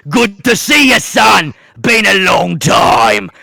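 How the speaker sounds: gloating villain calling out to his son's killer's son; booming villain taunt. Acted. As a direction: bellow the greeting like a gloating warlord. Villain Roland taunts Cyrus.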